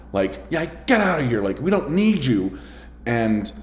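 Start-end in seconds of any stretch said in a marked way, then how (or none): 0.5-2.6 retold yelling.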